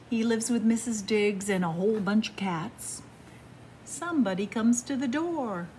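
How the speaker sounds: ordinary delivery, nothing marked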